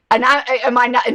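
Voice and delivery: usual speaking voice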